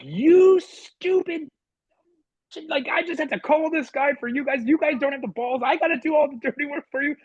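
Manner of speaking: high, loud ranting yell; yelling at the staff. Retold or imitated. Coach yelling at his fellow coaches, high and loud. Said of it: "I just start yelling at everybody".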